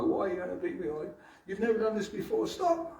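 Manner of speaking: very high, whimpering child's plea, lightly acted; a boy faking tears to win sympathy; mock sobbing plea rising to a squeak; crying child. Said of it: "He starts crying and rubbing his eyes and sobbing".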